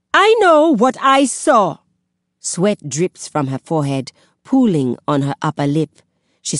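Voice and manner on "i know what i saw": high, loud furious retort; angry accusation. A narrator's reading. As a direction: fling it back in anger. Kaya accuses him.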